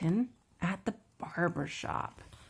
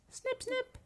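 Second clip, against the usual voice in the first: high, light little snips; scissor snips. A narrator's reading. Imitating scissors in the story.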